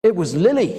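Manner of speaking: high, loud quick accusation; shifting the blame. A narrator's reading. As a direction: blurt the blame onto your sister. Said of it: "said Eric, shifting the blame".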